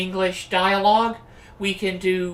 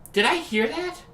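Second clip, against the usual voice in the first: put-on bright magical girl; 8 semitones higher than usual. Character hearing noise.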